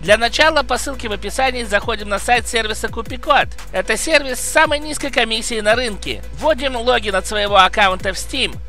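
brisk upbeat sponsor read. Acted. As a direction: sell it like a quick promo. (рекламная подача)

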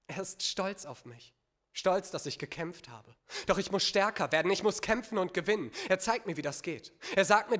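poetry slam recitation; spoken-word poem recital. Acted. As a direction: perform it as an intense personal poem, building then falling away.

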